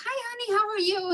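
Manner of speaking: high, bright-mooded hello to her husband; cheery greeting. Retold or imitated. Wife greeting her cranky husband after work, cheerful and high. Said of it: "I was in a good mood though".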